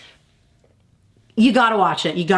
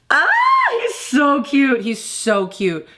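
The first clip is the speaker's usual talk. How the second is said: high, drawn-out squeal of adoration; fangirl gushing; 5 semitones higher than usual. Real, not acted. Fan swooning over a beautiful actor, gushing. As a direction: gush helplessly over your crush.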